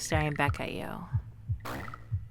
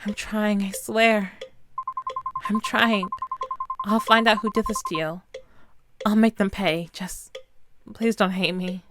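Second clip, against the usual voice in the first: higher, tearful begging, partly whispered; desperate pleading; 3 semitones higher than usual. Acted. Pleading with the dead Kazuki, tearful and desperate. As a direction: beg for forgiveness, voice cracking.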